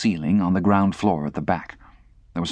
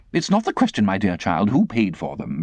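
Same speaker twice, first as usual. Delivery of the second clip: affected lady. Acted. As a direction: complain like a fussy old aunt.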